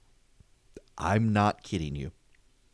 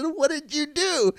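high, slow disbelieving cry; half-laughing, squeaky outrage; incredulous cry; slower than usual. Retold or imitated. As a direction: cry out in mock disbelief at the kid. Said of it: "I said".

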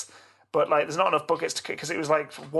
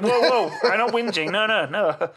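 defensive protest; 6 semitones higher, 6 dB louder than usual. Retold or imitated. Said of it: "I was like".

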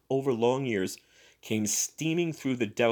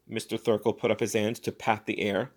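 usual speaking voice

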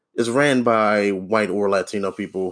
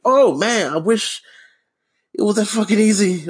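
mocking exasperation; 11 semitones higher, 3 dB louder than usual. Worker answering managers' "just be happy", high sarcastic exasperation. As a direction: throw the cheery advice back, pitched way up.

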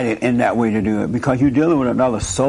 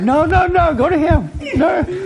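high playful protest; 14 semitones higher than usual. Real, not acted. Teasing refusal, high voice.

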